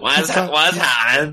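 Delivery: high, slow exaggerated Belfast accent; put-on Belfast accent. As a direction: lay the Belfast accent on thick. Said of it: "You don't need to put a Belfast accent on".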